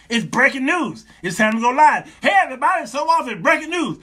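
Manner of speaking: loud, high-pitched live-show opener; breaking-news announcer. Acted. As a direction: burst on air with urgent news. Mimics going live with breaking news.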